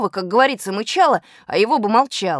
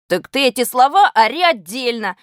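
raised, slightly louder retort; narrated shout; 4 semitones higher, 4 dB louder than usual. A narrator's reading. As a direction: shout back at a classmate. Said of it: "крикнул я Веньке".

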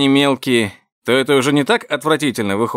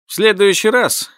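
worked-up; 9 semitones higher, 4 dB louder than usual. Acted. Derek getting worked up.

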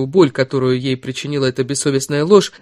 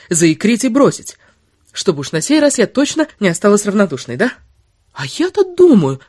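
higher-pitched indignant tirade, rising on the taunts; angry heroine, bitterly sarcastic; 6 semitones higher, 3 dB louder than usual. Acted. Woman berating the lover who deceived her, bitter and sarcastic. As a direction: pile up scornful accusations.

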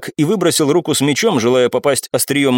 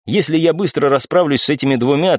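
ordinary delivery

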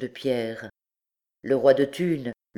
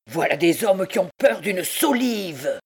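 higher, indignant exclamation; scornful taunt; 5 semitones higher than usual. A narrator's reading. Clopin mocking men afraid of a beam, scornful. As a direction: shame the cowards. Clopin mocks his men's fear.

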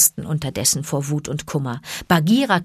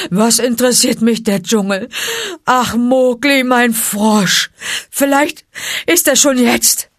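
loud, higher wail; lamenting bear; 6 semitones higher, 6 dB louder than usual. Acted. Baloo lamenting to Bagheera, loud and higher. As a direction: grieve for the boy.

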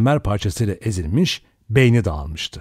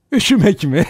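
high, loud, incredulous laughing question; 10 semitones higher, 5 dB louder than usual. A narrator's reading. Vera mocks Lombard's worry about cold, laughing. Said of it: "Vera kahkahayı bastı".

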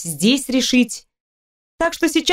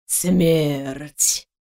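low ominous voice; 8 semitones lower than usual. Acted. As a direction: say it like Death at the door. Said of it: "низким зловещим голосом".

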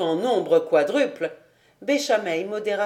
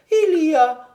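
insistent outburst; 7 semitones higher, 6 dB louder than usual. A narrator's reading. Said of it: "continua-t-il".

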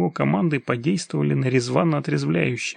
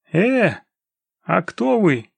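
raised-voice call, higher and louder; calling after; 5 semitones higher, 4 dB louder, much slower than usual. A narrator's reading. Kaloev calls after the departing scouts, raising his voice. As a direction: call out after people leaving. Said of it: "крикнул вслед Калоев".

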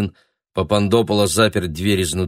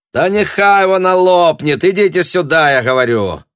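impatient shout; 10 semitones higher, 8 dB louder than usual. Acted. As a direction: yell an order through the door. Impatient shout from behind door.

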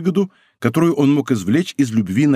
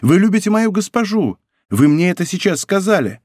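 high-pitched reproachful protest; hurt, exclaiming reproach; crying out; 6 semitones higher than usual. A narrator's reading. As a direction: protest with pitch held high, not shouting. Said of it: "вскричала Кэти".

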